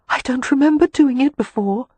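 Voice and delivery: high breathless woman; words short of breath. A narrator's reading. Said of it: "she said breathlessly".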